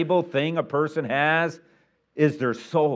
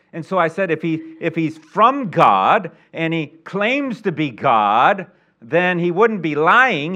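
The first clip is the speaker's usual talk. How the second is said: higher, louder, drawn-out key words; emphatic preaching; 5 semitones higher, 7 dB louder than usual. Acted. Pastor retelling his witness to a salesman, preaching forcefully. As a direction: drive the argument home from the pulpit.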